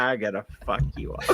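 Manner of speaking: quiet, evil smiling threat; evil whisper-threat. Shown as a demonstration. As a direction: grin and promise violence softly. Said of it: "just act evil about it".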